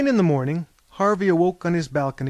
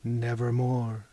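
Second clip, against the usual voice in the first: low, slow and grave; raven's reply; 4 semitones lower, 4 dB quieter, slower than usual. A narrator's reading. The captain answering Mahogany, low and slow. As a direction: draw it out like a tolling bell. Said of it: "Quoth the captain".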